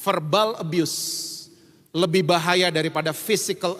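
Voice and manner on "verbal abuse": very slow, each word weighted; slow, grave emphasis on verbal abuse; slow weighty. Real, not acted. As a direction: let the phrase land slowly. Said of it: "slow stressed phrase".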